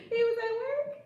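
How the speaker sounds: high, tickled squeal after laughing; amused squeal. Real, not acted. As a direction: repeat it up high, cracking yourself up.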